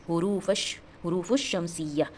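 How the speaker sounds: slow "हुरूफ शमसिया" with a held sh and no l; doubled sh. Shown as a demonstration. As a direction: lean on the sh in "शमसिया". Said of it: "तशदीद आया है".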